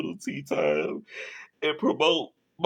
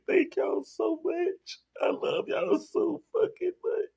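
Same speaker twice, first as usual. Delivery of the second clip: crying; 11 semitones higher, 4 dB quieter than usual. Real, not acted. Tearful thanks.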